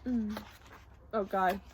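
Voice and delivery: plain reading voice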